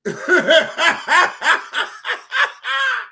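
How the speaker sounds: loud, shouted burst of laughter. Real, not acted. Cracking up loudly at the story. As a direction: howl with laughter.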